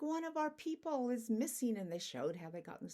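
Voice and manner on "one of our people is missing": panicky group. Acted. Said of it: "They're like".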